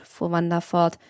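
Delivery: ordinary delivery, nothing marked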